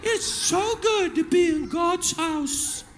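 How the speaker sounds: high, slow, softened sugary praise; hammed-up holy voice, drawing out every phrase; mock pious churchgoer. Cusser arrives at church putting on praise, mock pious. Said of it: "you get to church and you like".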